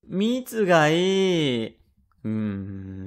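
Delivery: put-on very slow, drawn-out childish plea; whining child. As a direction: insist like a pouting little boy. Child whines for syrup.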